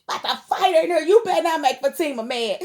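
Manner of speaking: real anger. Real, not acted. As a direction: warn him off, fired up for a fight. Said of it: "I'm so mad at him".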